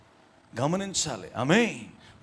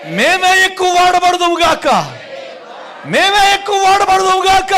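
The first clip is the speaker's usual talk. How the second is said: much higher and louder, a roaring declaration; preaching at full force; 16 dB louder than usual. Acted. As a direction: bellow the blessing over the hall. Preacher leads congregation shout.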